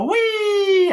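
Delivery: high, loud playground squeal; mock merry-go-round whoop; gleeful wee. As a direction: squeal like a kid on a ride. Mimics riding the karma wheel.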